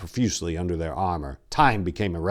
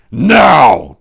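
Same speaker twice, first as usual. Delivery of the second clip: growled; 7 semitones higher, 16 dB louder than usual. Acted. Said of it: "Tor growled".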